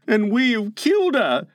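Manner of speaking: high, mildly hoarse voice. Acted. Boy realises they killed her, slightly hoarse. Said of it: "Nibs said hoarsely".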